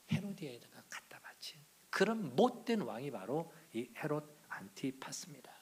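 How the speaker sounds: hushed dramatic whisper. Real, not acted. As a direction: breathe it out in a whisper. Preacher drops to dramatic whisper. (속삭이듯이)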